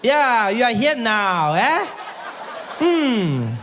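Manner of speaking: high, loud, slow gloating; taunting receptionist. Retold or imitated. Receptionist gloats over comedian patient, high taunting voice. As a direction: gloat loudly with drawn-out words. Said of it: "She's like".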